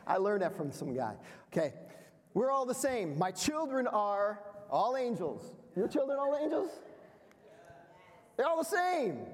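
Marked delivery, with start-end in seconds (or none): 2.3-9.2 high, sing-song mock innocence; mock naive claim. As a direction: play the naive proud parent. Mocking naive parent belief.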